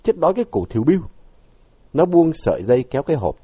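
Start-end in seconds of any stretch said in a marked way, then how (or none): 0.0-1.0 higher-pitched, irritable retort; mildly voiced annoyance; irritated. A narrator's reading. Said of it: "Vũ cáu tiếp".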